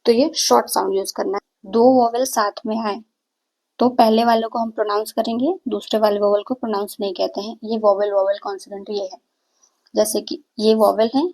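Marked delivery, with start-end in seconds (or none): none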